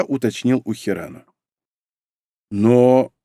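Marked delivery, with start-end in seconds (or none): 2.5-3.1 mildly surprised single word. A narrator's reading. Mizuki learning nobody can read minds, surprised. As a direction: let it out in puzzled surprise. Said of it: "удивилась Мизуки".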